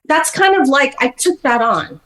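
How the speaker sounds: ordinary talk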